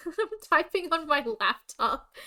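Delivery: said through loud laughter, slow; real laughter while talking; laughing. Real, not acted.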